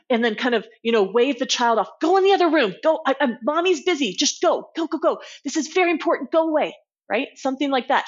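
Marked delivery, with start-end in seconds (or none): 2.0-6.8 put-on higher, hurried brush-off of the kid; busy parent shooing. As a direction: shoo the child away, mommy's busy. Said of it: "normal parent ... might go".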